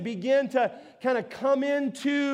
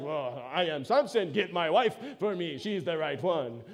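lower, dumb caveman voice with a thick, heavy tone; caveman Samson; 6 semitones lower, 4 dB quieter than usual. Retold or imitated. Preacher mocking Samson as a caveman, lower and heavy. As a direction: play him as a lunkhead brute demanding his wife. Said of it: "he sounds so cavemanish here".

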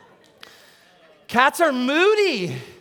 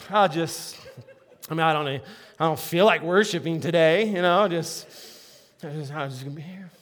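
lower, much softer, half-laughing mumble; a bored cat person who won't join the worship; unenthused churchgoer; 6 semitones lower, 5 dB quieter than usual. Retold or imitated. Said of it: "who are just like".

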